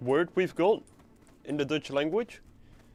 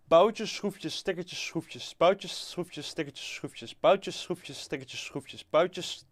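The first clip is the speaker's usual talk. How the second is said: tongue twister; 3 semitones higher, much slower than usual. Shown as a demonstration. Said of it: "I'm good at tongue twisters".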